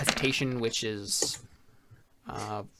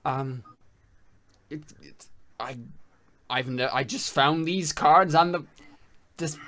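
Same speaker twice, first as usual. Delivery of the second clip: nervous stammer; 3 semitones higher, slower than usual. Acted. GM voices a scared NPC.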